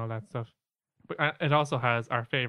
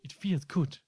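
lightly voiced accented spirit guide; a hint of comic spirit voice; Sam; 5 semitones higher, slower than usual. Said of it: "You should do a Sam impression".

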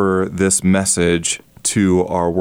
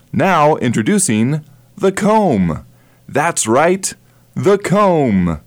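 big, higher pitchman reveal; infomercial host; 6 semitones higher, slower than usual. Shown as a demonstration. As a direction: announce the product like a TV pitchman. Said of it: "using the infomercial style".

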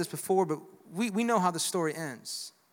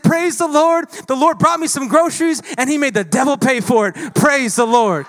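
high, loud jumping-for-joy praise; old woman rejoicing at the neighbor's groceries; jubilant praise; 6 semitones higher, 10 dB louder than usual. Retold or imitated. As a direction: celebrate like she's clapping and jumping. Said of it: "she starts jumping".

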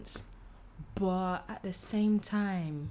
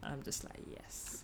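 whisper; 5 semitones lower, 16 dB quieter, slower than usual. Real, not acted. Silent triumphant reaction.

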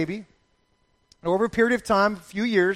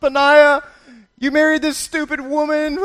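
high, louder mock jab at the husband; mock scolding; 7 semitones higher, 6 dB louder than usual. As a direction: tease him with a loud high jab.

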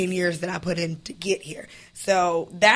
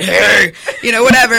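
morning voice; 2 semitones higher, 11 dB louder than usual. Retold or imitated. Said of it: "gargling cigarette ashes early in the morning".

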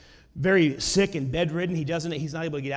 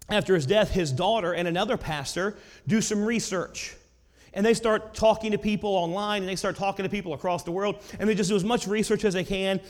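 usual speaking voice

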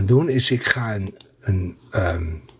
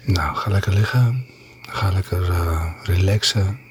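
asmr whisper; 6 semitones lower, 3 dB quieter than usual. Acted. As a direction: lull them to sleep. ASMR reading to listeners.

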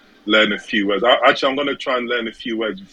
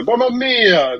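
put-on higher, loud Italian accent on Mamma mia; mock Italian accent; 11 semitones higher, 5 dB louder, slower than usual. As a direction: throw out a hammed-up Italian exclamation. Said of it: "I was mocking their accent".

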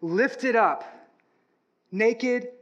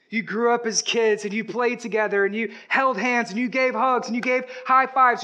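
ordinary talk

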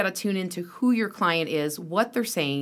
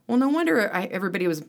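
ordinary delivery

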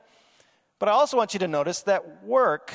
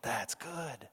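near-whispered, much softer repeat; hushed echo; 6 semitones lower, 14 dB quieter than usual. Retold or imitated. God savoring his work, hushed and satisfied. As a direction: let the second that's good fade to a breath. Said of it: "he goes like".

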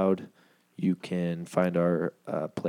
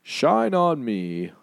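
movie trailer voice; 7 semitones higher, 5 dB louder, slower than usual. Acted. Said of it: "Read it like a movie trailer guy".

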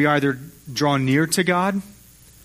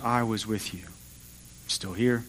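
gentle angel; 3 semitones lower, 8 dB quieter, slower than usual. Acted. Angel gently reassures Elijah.

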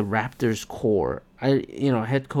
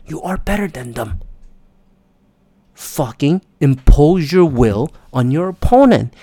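retold courtside yelling; 6 dB louder than usual. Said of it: "I was yelling the entire time".

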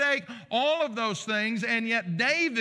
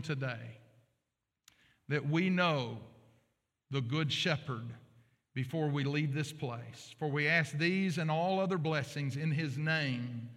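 prayer; 5 semitones lower than usual. Acted. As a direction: pray it gently to the Father. Opening prayer before sermon.

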